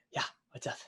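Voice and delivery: half-whispered, casual street thief. Acted. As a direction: toss it off quietly like a shifty pickpocket. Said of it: "he looks up at you and goes".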